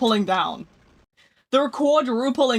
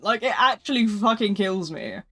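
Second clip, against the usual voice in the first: ordinary talk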